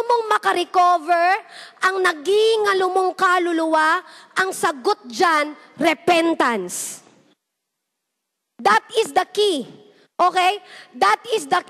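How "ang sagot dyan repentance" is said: ordinary talk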